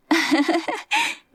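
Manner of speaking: high bright giggle; girl bursts into a giggle; giggling laugh. A narrator's reading. Sister remembering childhood, high giggling laugh. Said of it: "वो खिलखिलाकर हंस पड़ी".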